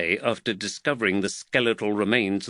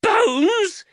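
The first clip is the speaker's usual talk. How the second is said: very high, loud shriek; piercing cry of a scandalised mother; horrified shriek; 6 dB louder than usual. A narrator's reading. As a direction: shriek the word in shock. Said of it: "Jaquetta Cagowan shrieked".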